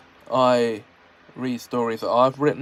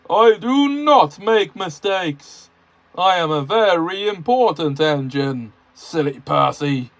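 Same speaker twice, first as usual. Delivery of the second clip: scoffing; 4 semitones higher, 6 dB louder than usual. Acted. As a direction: look down on little Percy. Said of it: "scoffed Gordon".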